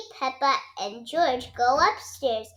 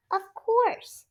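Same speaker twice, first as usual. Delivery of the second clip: higher, gentle mother's answer; Mummy Pig voice; 10 semitones higher than usual. A narrator's reading. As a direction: answer like a kindly mum. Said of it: "says Mummy Pig".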